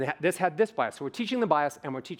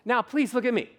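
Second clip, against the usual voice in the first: higher, singsong pleading; mock presenter; 6 semitones higher than usual. Speaker mocks a presenter calling attention back, singsong. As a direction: beg the audience to look back. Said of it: "And then".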